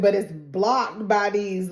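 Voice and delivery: ordinary talk, nothing marked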